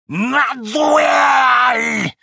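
put-on high, loud old man's shriek. A narrator's reading. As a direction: shriek the challenge, out of breath. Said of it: "завопил опять старикашка, задыхаясь".

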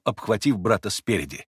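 ordinary delivery

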